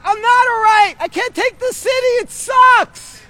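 high, loud real outburst; exasperated shouting. Real, not acted. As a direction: yell out that you are fed up. Venting loudly at the city.

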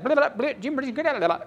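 high-pitched babble imitating tongues; imitated prayer-language babble; gobbledygook. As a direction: rattle off mock random syllables, high. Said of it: "praying in this gobbledygook".

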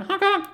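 two quick high squeaky honks; chicken honks. Retold or imitated. To the rock and roll bikers, high honking chicken noises. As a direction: mimic a rubber chicken, honk honk. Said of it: "you went".

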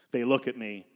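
ordinary talk, nothing marked

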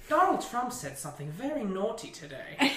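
prim mock British news accent, amused; British newsreader. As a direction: read it like a British news anchor. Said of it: "than watching British news".

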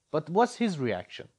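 normal talking voice

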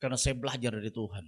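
low, hushed, voiced confiding; imitated whisper. Preacher modelling a confession to a troubled listener, mock whisper. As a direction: lean in and share a secret, dropping well down. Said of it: "Akhirnya saudara bisik lagi".

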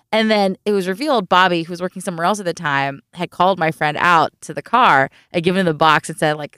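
ordinary talk

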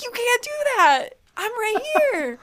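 high-pitched, indignant objection; high, exasperated squawk of disbelief; indignant protest. Acted. Acted protest in retold quarrel.

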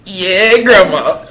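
high, loud mock answer to grandma; hammed-up stoned cheerfulness; giddy reply. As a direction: call it out in a comically high voice. Imitates own giddy reply to grandma.

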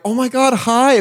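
high, gushing greeting; imitated customer-service cheer. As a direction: greet an older person extra sweetly. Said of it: "it's like customer service voice".

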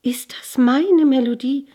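high, loud, eager question; excited cry. A narrator's reading. Girl hears a lovely melody, calls out excitedly. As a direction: burst out calling, pitch lifted. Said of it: "rief es da aus".

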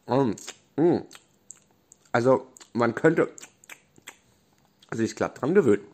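talking through a mouthful; mouth full. Acted. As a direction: chew and speak with full mouth. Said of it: "machte mit vollem Mund". (mit vollem Mund)

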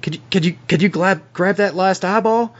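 stumbling, high-pitched request to a companion; John, timid request. Acted. Game master plays John asking Clarice.

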